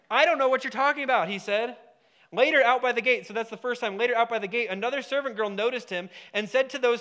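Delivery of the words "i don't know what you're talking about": raised, higher voice of denial; mild indignant denial. Acted. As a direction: act out a louder, higher protest. Peter's first denial, acted.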